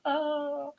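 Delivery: high, mock moan of pleasure; a sudden high hammed-up cry; mock orgasm yell. Husband mocking how women scream, hammed-up high cry. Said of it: "Is it more like a".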